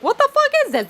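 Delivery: high-pitched, disbelieving retort; incredulous outburst. Retold or imitated. Retells own incredulous reaction.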